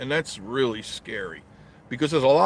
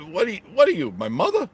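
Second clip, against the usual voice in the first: mock indignant; 11 semitones higher, faster than usual. Real, not acted. Teasing a viewer.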